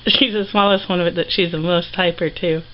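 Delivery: usual speaking voice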